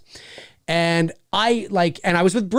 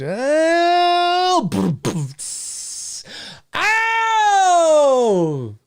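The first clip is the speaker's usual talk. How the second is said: high drawn-out mock wail with sputters; sputter, then wail long and high; comic howl; 8 semitones higher, much slower than usual. Host reacting to viewer's excuse, hammed-up wail.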